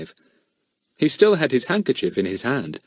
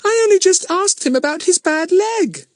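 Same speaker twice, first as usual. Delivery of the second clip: put-on girl's voice; 4 dB louder than usual. A narrator's reading. Betts defends herself.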